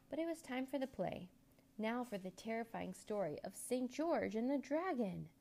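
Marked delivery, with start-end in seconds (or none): none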